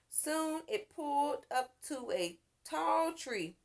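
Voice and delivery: ordinary delivery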